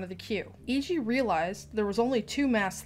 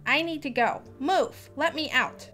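pushy demand; 6 semitones higher, 4 dB louder than usual. Acted. Mother pushing to leave.

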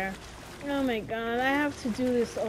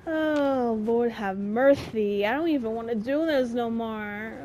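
ordinary talk

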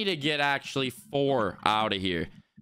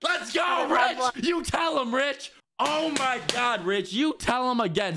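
excited; 9 semitones higher than usual. Real, not acted. Hyping up a friend.

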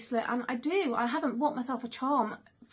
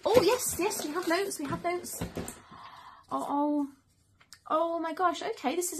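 high, thrilled burst of delight; excited; 6 semitones higher than usual. Real, not acted. Mother finds notes in the gift box, high and excited. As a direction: jump up in pitch with joy at the find.

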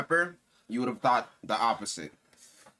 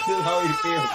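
mock whimper; 5 dB louder than usual. Mocking friend in hot sauce pain.

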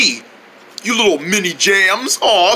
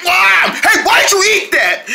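mock yell; 11 semitones higher, slower than usual. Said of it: "you're gonna end up going like".

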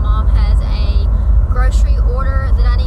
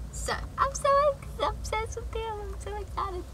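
excited squeal; 11 semitones higher, 17 dB quieter than usual. Real, not acted. Gushing about her new nails.

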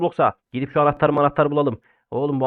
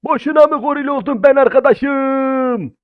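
drawn-out complaint; 9 semitones higher, 4 dB louder, slower than usual. Shown as a demonstration. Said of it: "repeats the same line".

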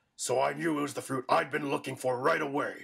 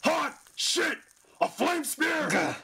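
alarmed yell; 12 semitones higher, slower than usual. Acted. Villain burned, panicking.

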